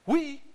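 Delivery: "We" leapt up high and hit hard; emphatic We. Shown as a demonstration. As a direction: punch the word "We" up high. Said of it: "a very emphatic, an emphatic pronoun".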